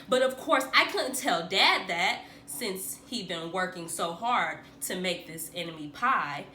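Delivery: plain reading voice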